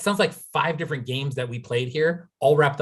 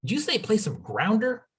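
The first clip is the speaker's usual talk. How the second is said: surprised question; 10 semitones higher than usual. Real, not acted. Surprised at unknown Canadian word.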